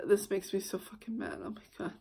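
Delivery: seething whisper under her breath; whispered fury. Real, not acted. To her viewers, still mad at the memory, whispering. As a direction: hiss the anger out in a whisper. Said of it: "Oh, this makes me fucking mad".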